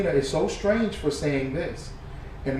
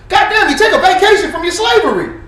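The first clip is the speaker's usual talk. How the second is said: mocking angry shout; 10 semitones higher, 10 dB louder than usual. Real, not acted.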